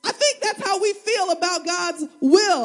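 ordinary talk, nothing marked

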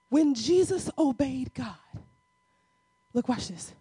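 ordinary talk

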